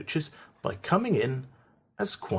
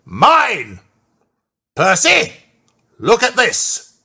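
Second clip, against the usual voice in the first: loud angry outburst; controller furious; 8 semitones higher, 9 dB louder, slower than usual. A narrator's reading. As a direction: bellow at Percy over the ruined hat. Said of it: "the fat controller came forwards ... and said".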